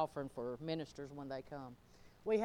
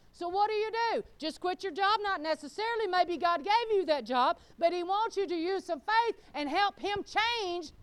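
high, loud, driving sermon delivery; full-force preaching; 10 semitones higher, 9 dB louder than usual. Real, not acted. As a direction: preach it hard to the whole congregation.

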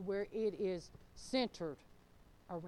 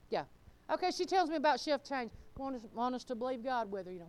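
ordinary talk, nothing marked

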